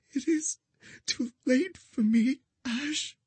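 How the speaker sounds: high, fading weak voice; bleeding out. A narrator's reading. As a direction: let the voice fade away. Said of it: "her voice growing weaker".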